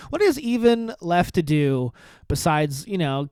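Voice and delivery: normal talking voice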